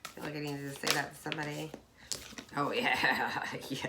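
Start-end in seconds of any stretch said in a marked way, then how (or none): 2.6-3.9 whispered aside. Real, not acted. Aside to self.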